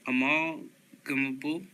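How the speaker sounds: "Amalgamable" slowly in two pieces, rising at the end; slow, questioning retry. Real, not acted. Reader retrying a tricky word, slow and questioning "Amalgamable". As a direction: break "Amalgamable" after "Amal" and lift the ending. Retries hard word, then laughs.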